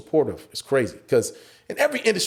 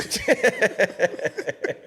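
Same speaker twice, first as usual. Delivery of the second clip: high chuckling; real laugh; 11 semitones higher than usual. Real, not acted. Comic amused by his weight joke, real laughter. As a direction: laugh along with your joke.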